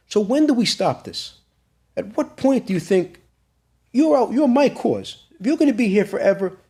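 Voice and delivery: high-pitched, pressing questions; impassioned plea. Retold or imitated. Son asking father if the grandkids are expendable, high and impassioned. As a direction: re-enact a son challenging his father, voice lifted well up.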